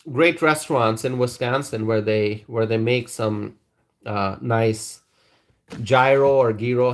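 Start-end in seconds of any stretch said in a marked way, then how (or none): 5.8-6.9 first gyro as JAI-roh, then gyro as GHEE-roh; gyro or gyro, JYE-roh then GEE-roh; two pronunciations contrasted. Shown as a demonstration. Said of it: "however you pronounce it".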